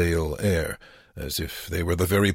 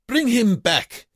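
very high, loud, drawn-out yell; guard's shout; 5 dB louder, slower than usual. A narrator's reading. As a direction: holler the command across the yard. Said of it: "shouted the guard".

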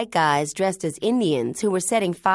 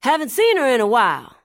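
calling out; 5 semitones higher, 5 dB louder, faster than usual. Acted. Hal still calling after her.

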